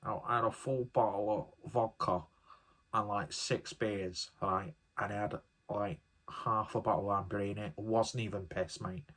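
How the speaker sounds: lightly put-on Mancunian accent, bragging teenager; mild Mancunian accent, imitating a teenager bragging about drinking; mock Mancunian teen. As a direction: take off a boastful Manchester schoolkid in a Mancunian accent. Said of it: "why this person's got a Mancunian accent".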